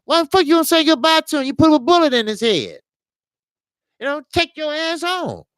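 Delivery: an indignant rapid-fire tirade; furious rant. Real, not acted. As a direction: blast him for what he said. Outraged at the killer's words.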